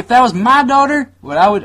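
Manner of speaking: put-on accidental slip into mom's Southern accent. Son replying to mom, stuck in Southern accent. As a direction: answer in the same high Southern voice. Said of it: "I don't know why I did her accent".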